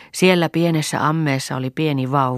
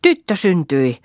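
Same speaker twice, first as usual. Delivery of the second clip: midwife's raised, higher-pitched announcement; higher, louder exclamation, falling at the end; calling out news; 5 semitones higher, 7 dB louder, slower than usual. A narrator's reading. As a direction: call it out loudly from a doorway. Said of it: "huusi kätilö kamarin ovesta".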